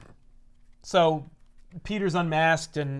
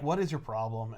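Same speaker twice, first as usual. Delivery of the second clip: low, softer deadpan challenge; Cap, unimpressed; 6 semitones lower, 6 dB quieter than usual. Acted. Cap looking at a panicking boss, low and unimpressed. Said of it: "Cap is just looking at him like".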